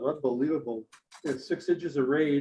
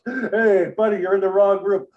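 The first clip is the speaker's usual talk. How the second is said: raised, higher mock shout; calling out to intruder; 6 semitones higher, 7 dB louder than usual. Guest to drunk Scotsman in wrong room, calling out. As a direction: call out sharply to a stranger.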